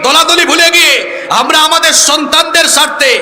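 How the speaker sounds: rally the whole crowd phrase by phrase; high, emphatic platform appeal; rallying preaching. Real, not acted. Rallies crowd in one demand.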